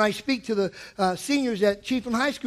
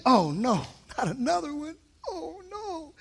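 mock crying; 3 semitones higher, 8 dB quieter than usual. Mock dismay at another quote.

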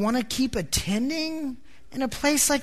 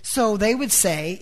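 ordinary talk

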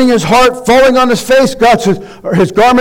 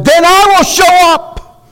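higher, slow and solemn divine pronouncement; voice of God; 8 semitones higher, 3 dB louder, slower than usual. Acted. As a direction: draw it out as God making a promise. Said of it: "And God says".

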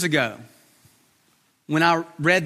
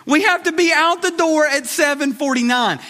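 put-on dad to kid; 9 semitones higher than usual. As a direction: hurry the kid out the door. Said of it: "I'm like".

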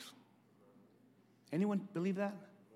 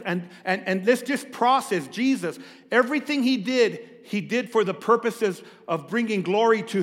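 loud, fervent preaching; 4 semitones higher, 7 dB louder than usual. Acted. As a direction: preach it with full force.

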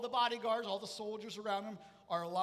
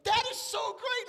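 high, loud, slow mock gush; gushing shoppers; 14 semitones higher, 9 dB louder, slower than usual. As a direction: gush like delighted bystanders. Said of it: "everybody else in the store is like".

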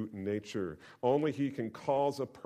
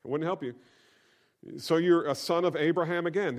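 usual speaking voice

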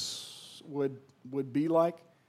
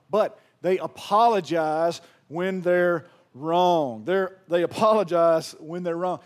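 loud emphasis; 10 dB louder, slower than usual. Acted. Sermon point, loud emphasis.

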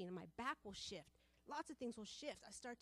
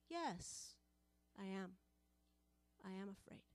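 usual speaking voice

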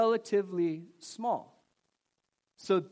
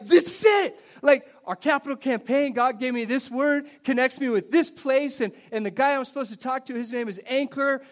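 higher, louder, thrilled quote; excited retelling; 5 semitones higher, 6 dB louder than usual. Retold or imitated. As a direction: blurt it out like a man who just got his sign.

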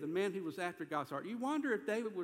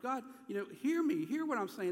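high, urgent appeal; pleading; 7 semitones higher, 3 dB louder than usual. Acted. David hurting, asking God to hear him, pleading. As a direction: plead to be heard, pitched up. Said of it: "he's just pleading".

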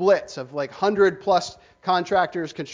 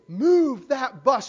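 mock scream; 6 semitones higher, 4 dB louder, much slower than usual. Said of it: "we're ready to scream".

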